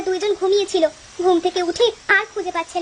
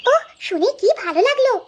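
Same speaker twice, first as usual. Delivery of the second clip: put-on child voice; 6 semitones higher than usual. Children delighted by the menu.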